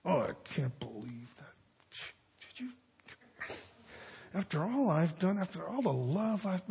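hushed, wounded muttering to oneself; mock hurt stewing. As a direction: act out stewing over a letdown, softly. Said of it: "sit and stew on it".

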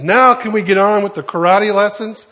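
high, loud, exasperated demand; acted pushy impatience; impatient kid. Impatient kid demands his lesson.

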